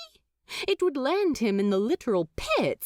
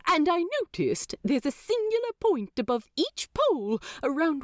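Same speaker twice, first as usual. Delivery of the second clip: fast, higher teasing demon voice; quick sly demon teasing the angel; 7 semitones higher than usual. Acted. Demon character voice.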